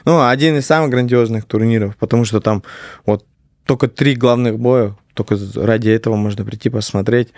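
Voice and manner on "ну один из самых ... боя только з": normal talking voice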